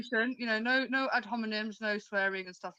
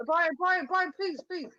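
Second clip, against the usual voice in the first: high, urgent calls of a name to break in; pleading for calm; 7 semitones higher, slower than usual. Real, not acted. Host pleading with Brian to stop interrupting, urgent and high. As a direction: beg them to stop talking over each other.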